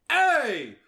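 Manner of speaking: high imitated New York opener; Markiplier's yell. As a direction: holler a mocking New York greeting. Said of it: "always have to yell".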